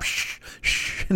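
soft, voiceless hushing; shushing. Pretend. Host silencing imagined critics, hushing softly. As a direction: hush an imagined heckler.

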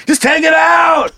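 high, slow shouted heckle; drunk heckler. Pretend. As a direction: yell like a drunk fan.

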